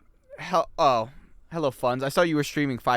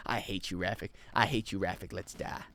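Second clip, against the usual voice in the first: low, soft resentment; defeated grumble; 9 semitones lower, 11 dB quieter than usual. Acted. Gamer giving up on guide Rafik, quiet and resentful. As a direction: sink into quiet, dejected hatred.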